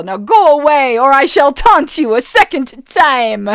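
loud, mocking French knight; Monty Python French taunter. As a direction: taunt like the castle guard. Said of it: "the guys on top of the castle on Monty Python".

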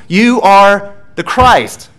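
loud, high and very slow, every word weighted; proclaimed confession. Acted. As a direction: ring out the confession like a creed. Climactic confession in sermon.

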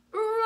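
loud, high dinosaur roar; big forte roar. Acted. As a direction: roar strong like the biggest dinosaur. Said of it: "singing nice and strong at a forte dynamic".

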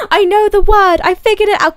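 high, loud, through real laughter; laughing triumph. Real, not acted. Laughing at her own slip over a word, triumphant. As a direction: crow it while laughing at yourself.

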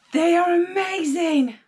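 real gasp of delight. Real, not acted. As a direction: gasp then gush. Gasp at new earrings.

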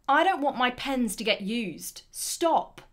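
loud emotional protest ending in a command; commanding, emotive. Acted. Someone protecting their pens from use, commanding and emotive. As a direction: object loudly, then order them to stop. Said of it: "that's a commanding word".

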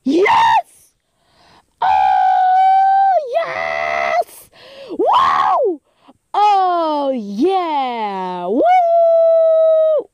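real high-pitched screams of triumph; loud, piercing celebration shrieks; ear-splitting victory cheer. Real, not acted. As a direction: scream and whoop for the win, drawing words out. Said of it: "Sorry if I broke your eardrums".

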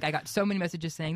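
normal talking voice

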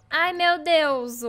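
loud, high, drawn-out exclamation; overwhelmed gushing. Real, not acted. Streamer reacts to cute chicks, loud overwhelmed gushing. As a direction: cry out slowly at the cuteness.

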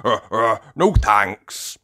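loud, stammered quick refusal; hasty refusal. A narrator's reading. Grandad Dog dodges Grampy Rabbit's tales, hasty refusal. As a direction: cut in to decline. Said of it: "says Grandad Dog quickly".

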